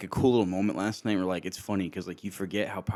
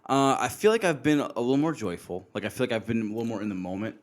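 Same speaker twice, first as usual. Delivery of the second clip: normal talking voice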